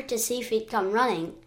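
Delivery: ordinary delivery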